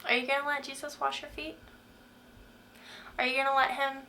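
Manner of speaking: normal talking voice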